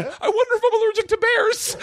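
very high, laughing panic; squeaky, laughing worry; high laughing inner panic; panicked thought. Retold or imitated. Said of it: "I was thinking".

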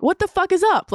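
high-pitched playful challenge; joking confrontation. Retold or imitated. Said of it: "I was laughing".

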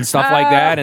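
normal talking voice

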